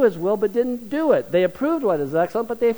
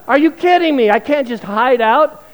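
much louder, higher are you kidding me; loud disbelief; 6 semitones higher, 9 dB louder than usual. Retold or imitated. Pastor recognised by a stranger on the plane, loud exasperated disbelief. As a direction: groan it out, caught and exposed.

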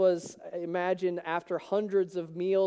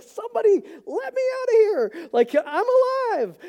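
much higher, loud, giddy voice; high, excited cry of relief; excited Lazarus; 13 semitones higher, 5 dB louder than usual. Retold or imitated. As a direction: squeal it like a freed man. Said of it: "he's like".

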